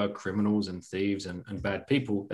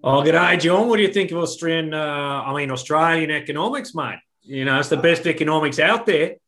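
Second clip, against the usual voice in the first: hammed-up Aussie accent, higher pitched; Australian accent; 5 semitones higher, 4 dB louder than usual. Shown as a demonstration. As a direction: ham it up as a matey Australian. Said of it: "we can do a good Aussie accent".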